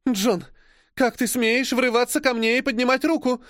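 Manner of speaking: high, tearful outcry; sobbing cry. Acted. Slapped Frenchwoman crying out at John, a touch of sobbing. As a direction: cry out at him through sobs. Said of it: "всхлипывая, кричит француженка".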